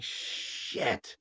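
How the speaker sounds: breathless whispered shit, almost soundless; whispered curse. Retold or imitated. Shocked reaction retold, I was like.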